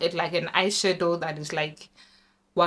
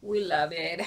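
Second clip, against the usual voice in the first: usual speaking voice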